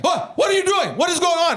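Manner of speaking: high, shouted dad impression; startled dad. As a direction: demand answers as the alarmed father. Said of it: "He goes, my dad's like".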